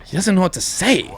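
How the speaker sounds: normal talking voice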